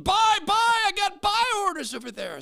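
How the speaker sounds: high, full-volume shout across the trading floor; trading-pit yell. Acted. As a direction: holler orders like an old pit trader. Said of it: "in the pit yelling".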